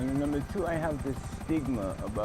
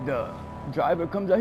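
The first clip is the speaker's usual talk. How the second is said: usual speaking voice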